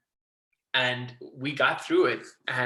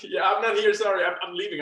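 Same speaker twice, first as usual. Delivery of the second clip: raised, higher pretend quitting; mock panicked refusal; 7 semitones higher, 5 dB louder than usual. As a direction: bail out in a playful panic. Acts out quitting on the phone.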